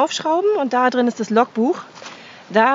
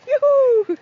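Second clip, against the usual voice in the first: high happy yell; cheering; 3 dB louder than usual. Real, not acted. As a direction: shout for joy. Cache found, cheering.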